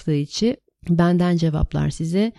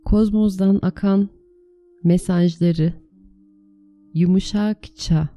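guided meditation; 3 dB louder than usual. Acted. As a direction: lead them calmly into the meditation. (sakin rehberli meditasyon sesi)

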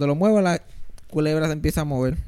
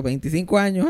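ordinary delivery

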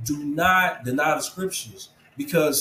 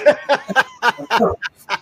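high burst of real laughter; guest's teasing cracks him up, a loud real laugh; 9 semitones higher, 7 dB louder than usual. Real, not acted. Laughing at the humble flex joke.

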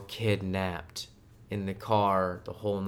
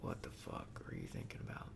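hushed, breathy mock horror; imagined stranger's reaction; 17 dB quieter than usual. Imagining people hearing her thoughts, a whispered appalled reaction. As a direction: whisper it like a shocked onlooker. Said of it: "they'd be like".

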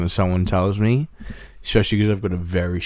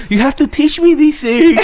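imitating mum; 6 dB louder than usual. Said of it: "she's like".

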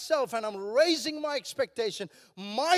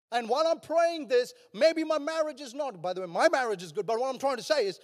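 ordinary delivery

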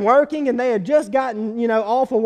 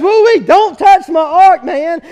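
higher, louder, teasing warning; God scolding with a grin, louder; God teasing a man away from his ark, louder; playful voice of God; 5 semitones higher, 9 dB louder than usual. Acted. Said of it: "And God says".

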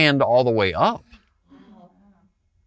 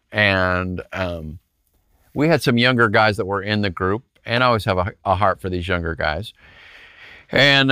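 ordinary talk

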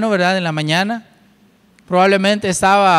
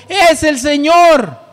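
higher, louder and slow exclamation; exclaimed; 8 semitones higher, 4 dB louder, slower than usual. Shown as a demonstration. Preacher showing the church John's exclamation, exclaimed. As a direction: shout it out as the text's exclamation marks demand. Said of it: "tiene signos de exclamación".

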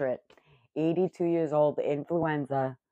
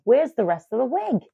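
retold shock; 8 semitones higher than usual. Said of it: "I'm like".

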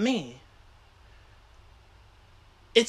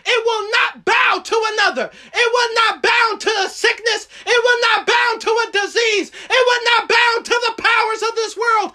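shouted high preaching climax; roaring rallying speech; 11 semitones higher, 3 dB louder than usual. Acted. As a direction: roar it out like a revival. Climax of sermon, shouting declarations.

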